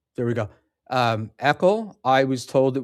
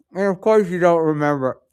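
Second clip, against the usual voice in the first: quick, louder, higher jab; scornful retort; 6 semitones higher, 6 dB louder, faster than usual. Real, not acted. Host answering someone who doesn't remember, scornful retort. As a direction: toss it back loud and fast. Scoffing at someone's claimed forgetfulness.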